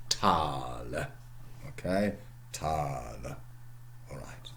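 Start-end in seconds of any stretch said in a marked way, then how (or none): 0.1-0.9 "tal" with a long drawn a, lower; Middle English tal. Shown as a demonstration. A lecturer demonstrates Middle English lengthening, long tal. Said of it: "becomes something like, in Middle English". 2.5-3.4 "Tal" with a long a, lower and softer; lengthened tal. Shown as a demonstration. A lecturer repeats the lengthened Middle English vowel.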